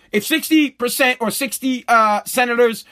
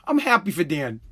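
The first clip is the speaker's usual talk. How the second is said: soft deadpan; 7 semitones lower, 5 dB quieter than usual. Real, not acted.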